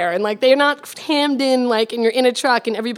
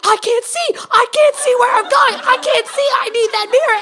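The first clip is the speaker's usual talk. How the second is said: much higher, louder frantic cry; mock panic; 12 semitones higher, 5 dB louder than usual. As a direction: wail it like a panicking driver. Driver panics after mirror flattened.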